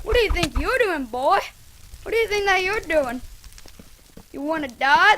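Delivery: loud mocking challenge; taunting. Real, not acted. Gamer taunting the enemy mid-fight, loud and mocking. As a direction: talk trash at the opponent.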